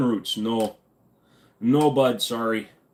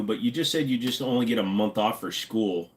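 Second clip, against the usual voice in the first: usual speaking voice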